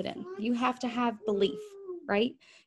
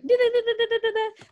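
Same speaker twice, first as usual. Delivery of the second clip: high, loud rapid chant; frantic da da da; 12 semitones higher, 4 dB louder, faster than usual. Retold or imitated. Coach imitating frantic panicked messaging. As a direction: rattle off nonsense syllables.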